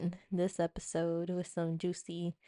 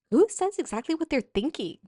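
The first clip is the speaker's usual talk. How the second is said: put-on high, loud ditzy girl voice; hammed-up Kelly from The Office; Kelly impression; 7 semitones higher, 6 dB louder than usual. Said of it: "when Kelly was like".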